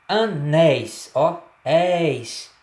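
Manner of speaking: "Anéis" spelled out slowly, open éis through the mouth only; drawn-out teaching demo of "anéis". Shown as a demonstration. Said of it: "pronúncia apenas pela boca".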